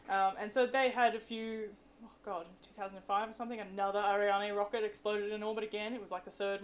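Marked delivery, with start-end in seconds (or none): none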